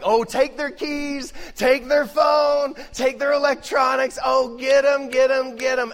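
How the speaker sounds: put-on high, loud, eager kid demanding punishment; vengeful kid. Parent imitating kids demanding vengeance, high and loud. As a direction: beg for punishment like a riled-up child. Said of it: "they come in like".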